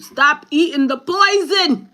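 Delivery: high, loud, drawn-out shouted command; scolding inner voice. Acted. Inner voice telling the speaker off about food, hollered. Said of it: "my inside keep telling me".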